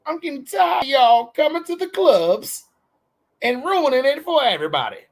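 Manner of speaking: high-pitched exasperated comic rant; Katt Williams. Acted. Host telling off club-ruining Karens, high Katt Williams impression. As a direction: whine and scold in a high, loud comedian's voice. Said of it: "it's my Cat Williams voice".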